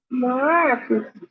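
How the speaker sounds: high, slow sing-song; sing-song teasing. Real, not acted. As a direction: stretch it out high, teasing the dog. Drawn-out high teasing to dog.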